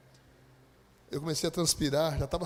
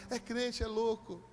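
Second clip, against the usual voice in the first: lightly voiced jeer, slightly raised; mocking onlookers; 4 semitones higher, 5 dB quieter, much slower than usual. Bystanders deride the praying believer, mocking. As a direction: imitate bystanders scoffing.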